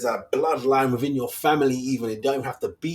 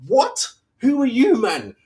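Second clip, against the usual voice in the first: high, loud incredulous "who are you"; sudden disbelieving outrage, pitched way up; indignant outburst; 13 semitones higher, 5 dB louder, slower than usual. Real, not acted. Outraged reaction to critics.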